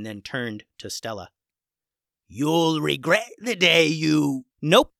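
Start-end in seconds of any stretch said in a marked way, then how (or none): 2.3-4.4 slow, louder villain threat; slow menacing threat. Acted. The pirate captain threatens Stella, slow and menacing. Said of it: "interrupted Stella". 4.6-4.9 loud flat cut-off; curt interruption. Acted. Stella cuts off the pirate captain, dismissive. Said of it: "interrupted Stella".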